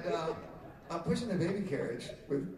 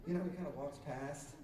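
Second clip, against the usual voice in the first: normal talking voice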